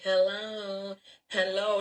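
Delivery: timid hello to a waiter; weak call. Retold or imitated. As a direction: call out meekly, barely trying. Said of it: "in a weak way".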